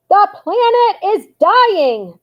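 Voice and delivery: high, slow protest chant; yelled. A narrator's reading. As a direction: yell it like a marching crowd. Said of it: "they yelled".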